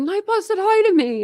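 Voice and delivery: high-pitched imitation of Eddie thrilled by the bot; excited chatter. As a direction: gush it like a delighted kid. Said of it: "'Cause he was like".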